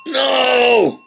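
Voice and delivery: high, loud, furious yell of no; angry cry. Acted. Angry child cries out at the teasing birds, loud. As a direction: let out a furious no. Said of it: "Ooh, temper, temper".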